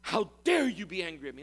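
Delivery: hammed-up offended gasp; mock offended. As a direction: gasp in mock outrage at being challenged. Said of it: "God was not offended".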